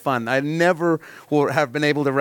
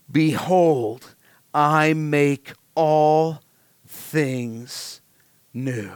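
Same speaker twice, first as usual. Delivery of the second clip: voice of God on the throne; much slower than usual. Retold or imitated. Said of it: "he who sat on the throne said".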